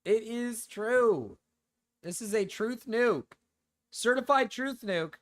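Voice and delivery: high, very drawn-out words; mock-solemn declaration. Streamer certifies the joke claim, mock-solemn declaration. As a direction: declare it slowly as certified fact.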